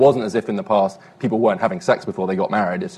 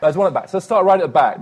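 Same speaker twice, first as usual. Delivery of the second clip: calling out to the back; 7 semitones higher, 4 dB louder than usual. Real, not acted. Pointing out mic at back.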